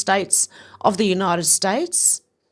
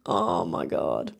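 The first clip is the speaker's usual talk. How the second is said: very low, slow, softer groaned words; low drawn-out exclamation; 5 dB quieter, much slower than usual. Real, not acted. Reacting to a post after laughing, low and slow. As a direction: drop right down and stretch it out.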